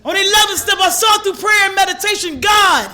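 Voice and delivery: loud, high preaching force; impassioned testimony. Real, not acted. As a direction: proclaim it to the whole room. Impassioned testimony to recovery meeting.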